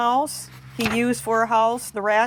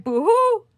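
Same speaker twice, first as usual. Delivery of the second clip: high wailing boo-hoo, much higher and louder; mock crying boo-hoo; 14 semitones higher, 6 dB louder than usual. The rat, woken by the sneeze, cries a high boo-hoo. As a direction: wail like a startled rat. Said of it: "who cried".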